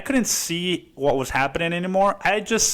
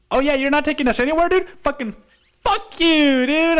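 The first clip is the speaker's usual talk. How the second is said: mock defiant yell; 10 semitones higher, 3 dB louder than usual. His defiant outburst at driver.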